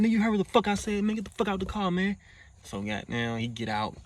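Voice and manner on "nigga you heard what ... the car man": high, fast, furious command at gunpoint; angry order. Retold or imitated. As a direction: bark it at him, fast and high. Robbing him, ordering him out.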